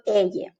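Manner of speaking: ella said as "EH-yeh", the Spanish letter name; ella with English Y in the middle; double L as Y. Shown as a demonstration. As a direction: say ella with a Y consonant, no L. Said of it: "when we pronounce the letter Y".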